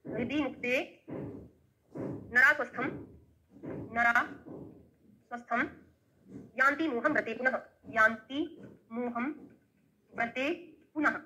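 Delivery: word by word with long pauses, for students to write down; dictating slowly. Shown as a demonstration. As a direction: dictate each word of the verse separately. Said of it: "लिख लो".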